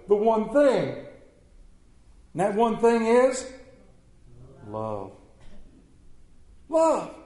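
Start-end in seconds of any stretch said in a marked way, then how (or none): 4.7-5.1 low, hushed single word; softly spoken. Real, not acted. Soft first take, then repeated loudly. 6.7-7.1 high, punchy single word; emphatic. Real, not acted. Emphatic repeat of soft word.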